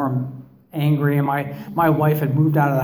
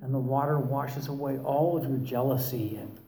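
imitating guided meditation voice; 8 dB quieter than usual. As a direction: lull them like a therapist leading a meditation. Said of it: "modulate your voice like that and talk like this".

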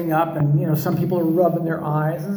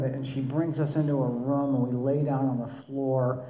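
ordinary delivery

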